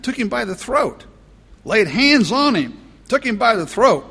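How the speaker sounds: high, loud, emphatic retelling; preaching at full force. Acted. Preacher hammering the servant's violence to the congregation, loud. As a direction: drive the violence home to the congregation.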